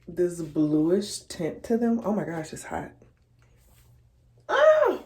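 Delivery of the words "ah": sudden high yelp of pain; a real burnt-finger yelp; sharp pained cry. Real, not acted. Burns finger on hot hair.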